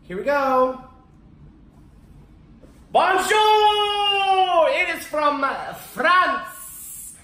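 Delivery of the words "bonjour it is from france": high, loud mock-French "Bonjour!"; French greeting. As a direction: belt out a cheery French hello.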